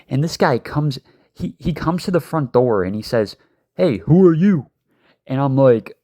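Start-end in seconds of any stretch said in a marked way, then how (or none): 3.8-4.7 higher, louder demanding voice of the big guy; confronting shout. Retold or imitated. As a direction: bark it at the stranger at the door. Boyfriend confronts delivery driver.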